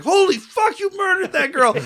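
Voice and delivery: high, loud shocked yell; shocked shout. Retold or imitated. Onlooker yelling at the killer, shocked and high. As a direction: yell it in disbelief. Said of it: "and he's like".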